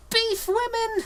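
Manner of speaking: very high excited cry; Hermione crying out. Acted. Reader voices Hermione shouting the password, very high. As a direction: squeal the password. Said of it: "Hermione cried".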